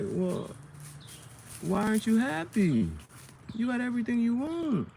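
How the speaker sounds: high, rising mock-puzzled voice; mocking onlookers. Mimicking people asking why he's unhappy, high mocking voice. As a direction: pipe up like nosy people.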